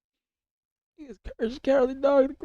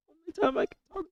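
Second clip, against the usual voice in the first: normal talking voice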